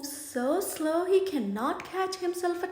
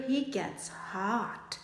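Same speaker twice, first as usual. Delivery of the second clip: plain reading voice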